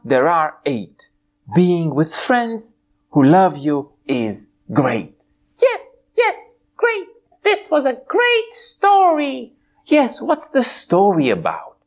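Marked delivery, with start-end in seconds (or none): 5.6-9.5 high bright pony voice; a cheerful high puppet pony. Acted. Twilight puppet answering host, high pony voice.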